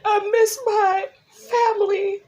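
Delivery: real tears while missing family; crying. Real, not acted. Widow missing her family, crying through it. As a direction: voice breaking high, slowed into sobs. Listing loved ones, breaks down.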